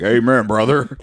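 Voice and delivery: a hint of a preacher's amen; mock amen. Pug agreeing through a mouthful, laughing amen.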